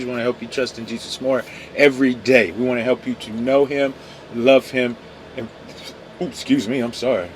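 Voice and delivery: ordinary talk, nothing marked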